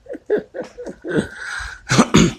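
high burst of real laughter; loud laugh. Real, not acted. Laughs at own joke.

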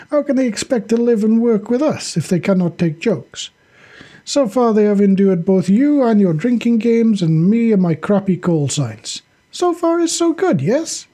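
high, lifted soldier voice; Sasha justifying himself. Acted. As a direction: make your case to the squad.

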